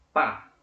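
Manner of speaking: "pá" in one loud burst; single syllable. Shown as a demonstration. Teacher showing one emission of voice, "pá" as a single loud syllable. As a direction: say "pá" as one strong, separate syllable. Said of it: "só uma emissão de voz".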